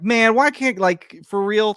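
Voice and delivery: mock outburst of fed-up disbelief; retold exasperation. Retold exasperation at squat toilets.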